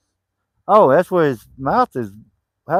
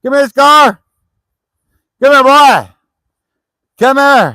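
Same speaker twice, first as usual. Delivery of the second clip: high, loud dog call; 10 semitones higher, 6 dB louder than usual. Real, not acted. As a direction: summon the dog with a raised, high-pitched voice. Calling the dog over.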